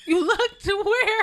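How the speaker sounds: high, slow, laughing disbelief; can't believe what he heard, laughing; squeaky, laughing "you looked where?"; incredulous laugh. Real, not acted. Host incredulous at the story.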